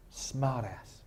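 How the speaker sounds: usual speaking voice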